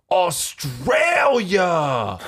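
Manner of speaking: put-on long, high drawn-out drunken shout; drunk Nick's bellow. Bouncer imitating trashed regular spotting Australians, drunken holler. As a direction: stretch the word out in mock amazement. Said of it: "the dude Nick was just like".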